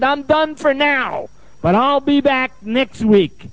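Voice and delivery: high, slow, drawn-out announcement; imitated worn-out declaration. Spent client to group, slow high declaration. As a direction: drag it out high, word by word.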